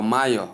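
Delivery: "mayor" as "ma-yo" with an AH first vowel; "mayor" starting like "my", ending in O; wrong mayor, my-yo. Shown as a demonstration. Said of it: "as some people may call it".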